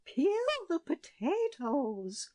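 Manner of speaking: high, slow, horrified echo; appalled cry. A narrator's reading. Queen appalled by her daughter's chores. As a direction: cry out in horror. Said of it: "cried the queen".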